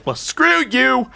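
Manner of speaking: high, drawn-out defiant jeer; triumphant taunt; gloating. Real, not acted. Gloats after winning.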